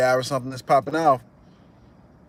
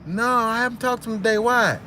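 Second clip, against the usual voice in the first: much higher innocent reply; naive kid; 10 semitones higher than usual. Retold or imitated. The cousin replying to the thug, high puzzled voice. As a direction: answer puzzled and unaware. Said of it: "he was like".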